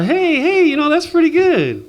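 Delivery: high-pitched, impressed exclamation; excited approval. Acted. Grandson praising grandpa's trick, excited and impressed. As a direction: pipe up, delighted by the trick.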